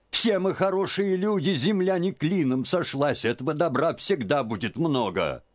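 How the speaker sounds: put-on high, pleading old woman; fretting old aunt. A narrator's reading. Aunt reasons with Liza. (тётка уговаривает Лизу, высоким голосом)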